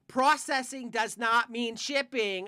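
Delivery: each word slow and loud, spelling it out; slow emphatic correction. Retold or imitated. Scolding a rude New York customer, each word slow and loud. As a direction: spell it out to a caller who won't listen.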